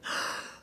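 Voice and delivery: sharp mock gasp; imitated crowd gasp. Crowd behind her hears the bang, imitated shocked gasp. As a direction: suck in air like startled onlookers. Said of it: "everybody behind me like".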